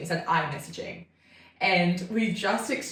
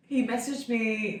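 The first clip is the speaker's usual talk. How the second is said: normal talking voice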